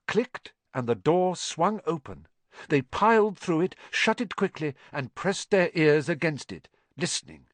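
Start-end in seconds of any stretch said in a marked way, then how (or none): none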